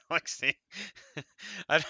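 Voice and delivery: breaks into laughter mid-word; laughing. Real, not acted. Reading a rule to viewers, laughing through it.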